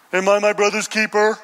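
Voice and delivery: Cain's snide, dismissive comeback; a touch of sarcastic defiance; snarky. Acted. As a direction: toss it off with a sneer at God. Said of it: "That's a little snarky".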